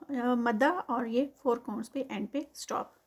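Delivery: ordinary delivery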